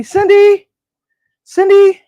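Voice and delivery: high, loud call across the room; calling the dog. Real, not acted. Calling out to the puppy Cindy.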